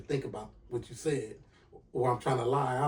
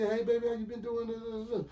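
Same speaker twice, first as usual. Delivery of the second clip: high, soft imitation of her greeting; excited girlfriend; 8 semitones higher, 5 dB quieter than usual. Girlfriend greets her man on the phone, imitated excitement. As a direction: mimic a girlfriend's pleased, pitched-up hello. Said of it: "So she's excited".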